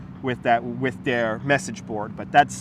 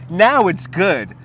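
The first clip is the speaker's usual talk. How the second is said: satisfied; 4 semitones higher, 4 dB louder, much slower than usual. Real, not acted. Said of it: "too much sugar for the sugar daddy".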